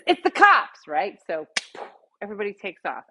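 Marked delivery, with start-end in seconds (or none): 0.1-0.8 alarmed warning. Retold or imitated. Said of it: "So somebody says".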